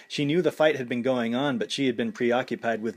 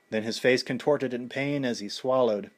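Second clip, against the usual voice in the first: usual speaking voice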